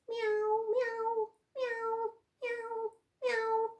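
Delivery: high kitten meowing; high repeated meows; cat meows. Acted. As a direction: meow like little cats. Imitating cats for children.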